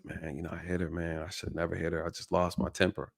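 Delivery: quiet confession. Retold or imitated. Man confesses to the officer.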